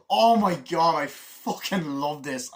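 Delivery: loud, high burst of delight; thrilled outburst; gushing. Real, not acted. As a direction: blurt it out in real excitement. Gushing over favourite scene.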